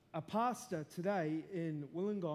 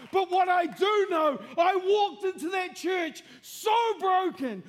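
high, loud, impassioned testimony; full-force preaching; 8 semitones higher, 7 dB louder than usual. Real, not acted. Preacher telling the congregation how God saved him, full-force shout. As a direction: cry it out to the room, stretching the key words.

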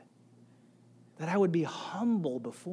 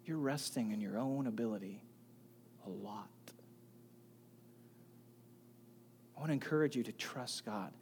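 soft, hushed and quick, like a dad taking you aside; hushed kindly pastor talking like a dad; kind hushed fatherly advice; 9 semitones lower, 17 dB quieter, faster than usual. Retold or imitated. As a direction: lean in and say it gently, almost a whisper. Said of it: "He did it very kindly".

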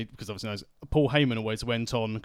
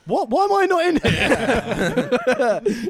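high, loud, slow laughing protest; mock outrage; 14 semitones higher, 9 dB louder, much slower than usual. Real, not acted. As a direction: drag out the mock complaint.

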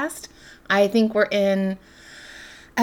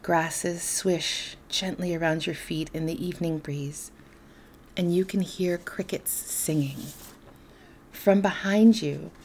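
softer, lower-key meditation voice with long pauses; guided meditation; 3 semitones lower, 7 dB quieter than usual. Acted. Host leading listeners into darkness and quiet, guided meditation. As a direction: guide them gently into calm, leaving space between phrases.

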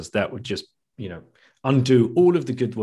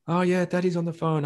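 put-on higher, small child's voice; lightly voiced young child, higher; 7 semitones higher, faster than usual. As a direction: play the little kid on the phone. Voicing his young child on phone.

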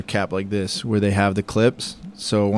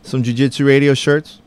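louder, higher, selling merch; promo shout-out; 4 semitones higher, 7 dB louder than usual. Acted. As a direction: hype up the listeners. Promo call to listeners.

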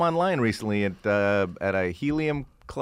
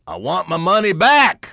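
higher, louder call from the back; heckler shout; 5 semitones higher, 8 dB louder than usual. Retold or imitated. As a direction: yell it across the room. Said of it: "you hear way in the back".

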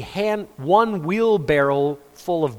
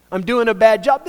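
mock worried God; 4 semitones higher, 6 dB louder than usual. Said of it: "stand back on day three and go".

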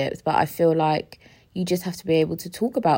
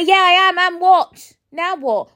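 loud, much higher shout; 12 semitones higher, 8 dB louder than usual. Real, not acted. Imagined reply to someone calling her emotional, shouting. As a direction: yell it back defiantly. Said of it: "Why am I shouting?".

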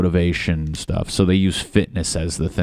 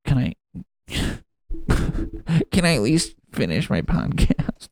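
whiny plea; 8 semitones higher than usual. Pretend. Pleading to finish podcast.

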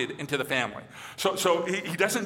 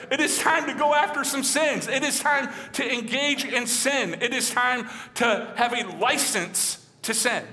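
higher, gleeful mock excitement; mock gleeful sinner; 7 semitones higher than usual. Preacher mocks churchgoers who misread grace, gleeful and higher. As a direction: ham up a sinner cheering the free pass.

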